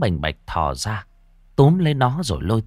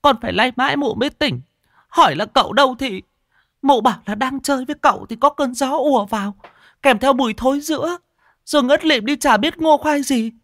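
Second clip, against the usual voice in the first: very high, loud, distressed account; high-pitched, frantic whimpering; tearful young woman; 5 dB louder than usual. A narrator's reading. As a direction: pour it all out in a high wail. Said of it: "Chị Ngoan mếu máo".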